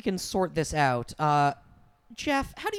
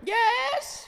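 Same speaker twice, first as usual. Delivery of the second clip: high ghost mother voice. Acted. Ghost mother Mauve answers call.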